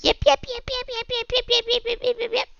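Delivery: high, rapid puppy yips; puppy yipping. Retold or imitated. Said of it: "bored of listening to yip".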